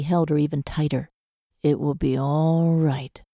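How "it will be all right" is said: slow, gentle reassurance; soothing. A narrator's reading. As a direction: ease her fears slowly. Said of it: "he soothed".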